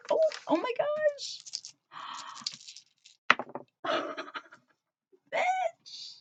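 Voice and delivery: very high, gasping squeal; thrilled amazement. Real, not acted. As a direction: squeak it out, stunned by the gift. Thrilled on opening the package.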